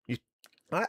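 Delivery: normal talking voice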